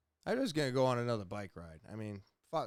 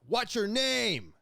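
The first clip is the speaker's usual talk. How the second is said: higher, louder, slow barked demand; retold yelling; 10 semitones higher, 5 dB louder, slower than usual. Armed officer yelling at him to give his name. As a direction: yell the question like an armed officer. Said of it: "Like, yelling it".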